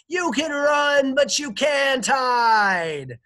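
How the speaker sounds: high, very loud drawn-out taunt; slow hollered threat to a fugitive; shout into darkness. A narrator's reading. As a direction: stretch each word as you yell across water. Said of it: "she shouted into the darkness".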